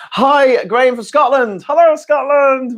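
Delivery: high, loud hello; pitched well up; excited greeting. Real, not acted. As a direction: greet Scotland with a big cheer. Enthusiastic greeting.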